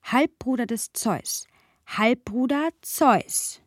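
slow, deliberate repeating of Halbbruder des Zeus; memorising aloud. Real, not acted. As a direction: say it over slowly to make it stick. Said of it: "versuche ich mir zu merken". (langsames Wiederholen zum Einprägen)

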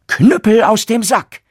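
loud, high commanding shout; called out. Acted. Turner shouting the command to unleash the cudgel, loud. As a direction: bark the spell with full force. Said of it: "rief er".

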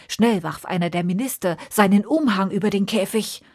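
ordinary delivery

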